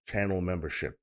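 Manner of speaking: ordinary delivery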